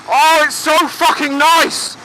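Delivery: loud, high cries of relief; blissful, drawn-out whooping delight; real elation. Real, not acted. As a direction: bellow with pleasure into the cool breeze. Riding into cool sea breeze.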